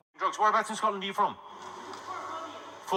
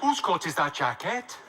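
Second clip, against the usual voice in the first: slow Welsh lilt rising at the end; confused Welsh accent; slower than usual. Shown as a demonstration. Comedian demonstrating the Welsh state of mind, confused Welsh accent. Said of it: "you've just gotta sound confused".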